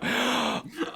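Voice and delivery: high gasp for air; acted gasp. Fire-breather running out of oxygen, gasping. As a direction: suck in air desperately.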